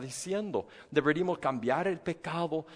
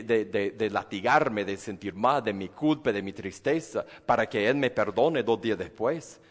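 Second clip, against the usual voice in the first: ordinary delivery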